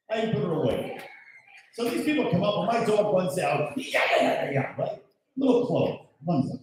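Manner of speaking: ordinary talk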